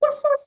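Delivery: high yappy woof woof; dog bark imitation. Shown as a demonstration. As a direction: bark like a playful little dog. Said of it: "Like this".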